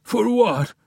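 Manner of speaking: high, loud, croaking disbelief; croaked in disbelief. A narrator's reading. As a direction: choke it out, stunned. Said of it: "he croaked incredulously".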